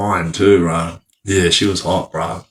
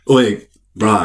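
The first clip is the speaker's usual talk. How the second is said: usual speaking voice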